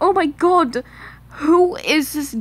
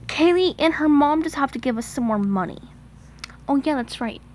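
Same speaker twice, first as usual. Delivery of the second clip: ordinary talk, nothing marked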